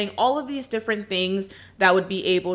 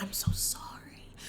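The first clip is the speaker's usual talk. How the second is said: mock whisper; 13 dB quieter than usual. [name] comforting Miranda, mock whisper.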